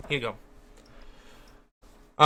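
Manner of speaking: cat talk. Real, not acted. Talking to cat.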